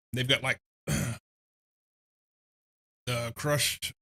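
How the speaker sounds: talking through a mouthful; words round a bite of food; mouth full. Real, not acted. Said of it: "don't talk with your mouth full".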